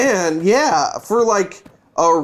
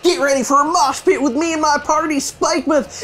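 rock star voice; 9 semitones higher than usual. Acted. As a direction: fire up the crowd like a punk rocker. Reading rocker gym leader's lines.

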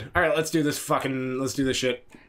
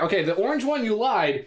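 ordinary talk

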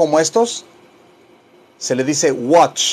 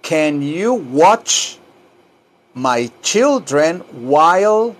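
very slow, word by word; drawn-out teacher enunciation; slow model phrase; slower than usual. Shown as a demonstration. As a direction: break it into chunks for learners. Teacher models sentence in chunks.

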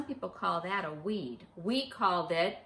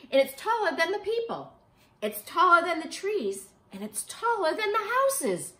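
higher and louder on each taller; rising amazement, bigger each time; building wonder; 10 semitones higher, 10 dB louder than usual. A narrator's reading. Giant flower growing.

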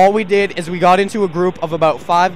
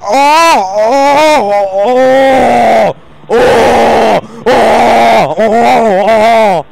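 long, high, very loud moans; hammed-up moans, drawn out at full volume; loud mock moaning; 4 semitones higher, 10 dB louder, much slower than usual. Imitating noises from the bathroom.

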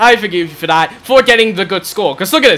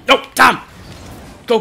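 ordinary talk

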